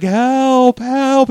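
loud, high cry for help; imitated scream. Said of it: "Like, screaming".

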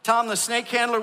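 usual speaking voice

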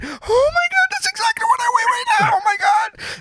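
shrieking very high in mock horror; mock horrified shriek. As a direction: squeal it like the scale just delivered terrible news. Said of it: "I'm like".

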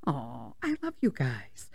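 very soft, low, drawn-out fondness; touched affection. Retold or imitated. Mom grateful to the kind coaches, touched and soft. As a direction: melt into a tender murmur.

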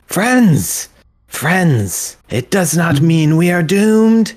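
high, loud calling out to the crowd; rallying call. Acted. Danica on the table rallies the crowd, calling out. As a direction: call the room to order.

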